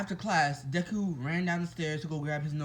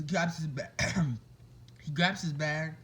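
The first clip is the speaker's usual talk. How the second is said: ordinary delivery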